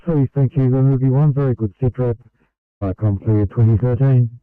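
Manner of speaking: clipped dispatcher over the radio; brisk, loud radio acknowledgment; terse radio update. Real, not acted. Dispatcher acknowledging sit rep on radio.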